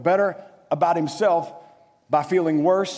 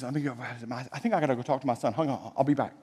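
much softer, low aside from a father; hushed father; 4 semitones lower, 6 dB quieter than usual. Retold or imitated. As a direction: quietly excuse yourself from the guests. Father excuses himself from party.

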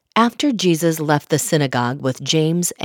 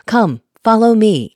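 higher, louder call; 6 semitones higher, 5 dB louder than usual. A narrator's reading. Jesus to fishermen on the shore, calling out. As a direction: call out to summon them. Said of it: "Jesus called out to them".

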